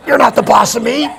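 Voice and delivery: ordinary delivery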